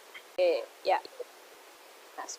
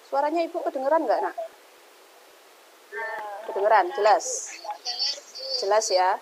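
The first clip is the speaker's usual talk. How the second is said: to someone hard of hearing. Real, not acted. Teacher checking audio in online class.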